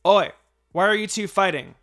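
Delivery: loud, high call to break up a fight; scolding shout. A narrator's reading. Sister breaking up her brother's fight, loud scolding. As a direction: snap at two fighting boys.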